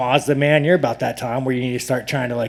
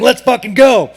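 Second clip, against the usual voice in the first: a hammed-up patriotic cheer, higher and louder; mock patriot yell; 7 semitones higher, 7 dB louder than usual. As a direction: yell it like a flag-waving fan at a rally. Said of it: "with the seventeen seventy-six flag saying".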